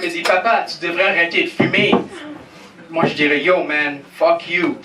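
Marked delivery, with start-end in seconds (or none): none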